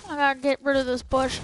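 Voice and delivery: normal talking voice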